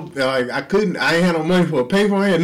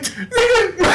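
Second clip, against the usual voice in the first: laughing exclaim. Real, not acted. Exclaiming at the guard.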